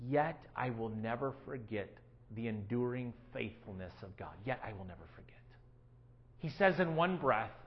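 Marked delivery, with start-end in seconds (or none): 0.1-4.3 low and hushed, leaning in; quiet. Shown as a demonstration. Pastor to congregation, hushed and intimate. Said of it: "preacher lowers voice". 4.4-5.4 hushed whisper of the line. Shown as a demonstration. Pastor whispering the verse to the congregation. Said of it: "whispered repetition".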